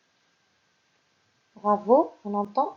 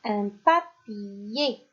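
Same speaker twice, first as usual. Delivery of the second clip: "un papier" very slow and clearly separated; slow dictation; much slower than usual. Shown as a demonstration. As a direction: stretch "un papier" for the class. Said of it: "Troisième mot".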